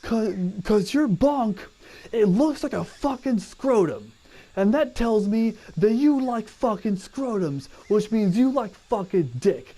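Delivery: high, slow, sneering sergeant voice; mocking drill sergeant. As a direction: taunt the recruit like a drill sergeant. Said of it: "He goes".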